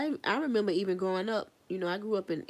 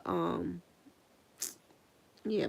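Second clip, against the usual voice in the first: usual speaking voice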